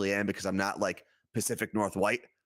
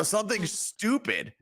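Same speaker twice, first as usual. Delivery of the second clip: dismissive; 8 semitones higher, slower than usual. Real, not acted. Mocking aside, high, slow.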